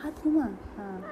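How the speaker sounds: usual speaking voice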